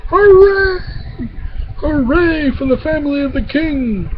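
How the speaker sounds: very high hooray, then high lighter cheering; crowd cheer. A narrator's reading. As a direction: cheer for the royal family. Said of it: "And the cheer went up".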